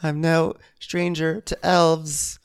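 high, slow drawn-out line; mock Whitney. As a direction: stretch it out like a sly Whitney. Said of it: "joke as Whitney".

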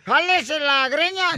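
drawn-out, deliberate slogan; slow imitation of a sidekick's catchphrase. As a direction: spell out each word slowly like a catchphrase. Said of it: "Piolín va".

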